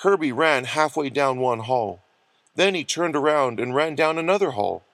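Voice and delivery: ordinary delivery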